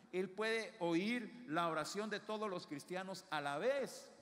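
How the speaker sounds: forceful preacher's proclamation; fervent preaching. Real, not acted. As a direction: declare each truth with conviction, pitched high. Preaches God's superiority with force.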